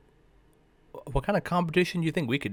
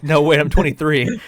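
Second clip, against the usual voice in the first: louder, higher panicked fix; mock-panicked blurt to the cashier; flustered correction; 4 semitones higher, 7 dB louder than usual. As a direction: scramble to correct yourself. Flustered correction to clerk, retold.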